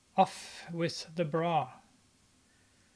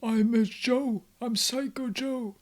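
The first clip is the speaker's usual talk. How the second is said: higher, put-on creepy villain voice; mock thug voice, pitched up; imitating Psycho Joe; 5 semitones higher than usual. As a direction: mimic the show's thug as he introduces himself. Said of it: "he talks like this".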